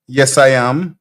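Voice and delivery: ordinary delivery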